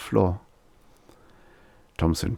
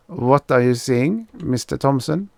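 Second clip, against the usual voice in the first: put-on German accent; 7 semitones higher than usual. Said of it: "a German accent".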